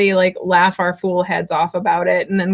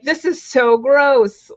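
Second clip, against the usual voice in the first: drawn-out disgust; 6 semitones higher, 3 dB louder, slower than usual. Retold or imitated. Voicing the disgusted moment.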